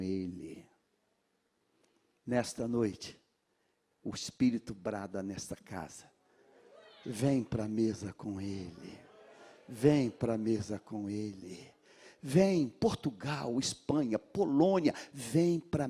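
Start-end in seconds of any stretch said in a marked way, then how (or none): 4.0-11.7 soft appeal. Real, not acted. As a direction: coax them gently to the table. Apelo suave do pregador. (apelo suave e lento)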